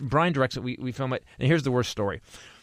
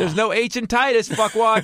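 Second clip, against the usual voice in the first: higher, louder mock yell; mock outrage; 9 semitones higher, 4 dB louder than usual. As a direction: bark it like an insult. Mock rant at barista.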